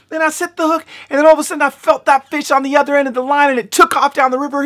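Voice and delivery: high, rushed excitement; keyed-up, high-pitched account; excited storytelling. Real, not acted. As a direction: race through the catch in a high voice. Breathless telling of the catch.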